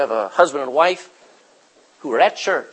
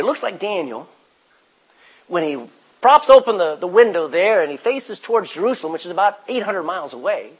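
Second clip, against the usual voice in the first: ordinary talk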